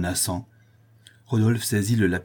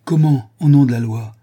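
loud, high, incredulous question; indignant bewilderment; shaken disbelief; 5 semitones higher, 10 dB louder than usual. A narrator's reading. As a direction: burst out as if your reason is reeling. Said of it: "dont la raison, fortement ébranlée".

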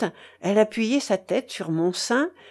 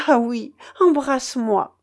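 raised, louder emotional exclamation; sobbing cry; 4 semitones higher, 5 dB louder than usual. A narrator's reading. Sister to her brother after the rescue, tearful exclamation. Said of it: "s'écriait-elle en sanglotant".

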